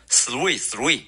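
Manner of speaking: three wrongly as "s-rui", with s for th; three with a hissed s instead of th; Chinese learner's mistake. Shown as a demonstration. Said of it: "很多中国同学会读成".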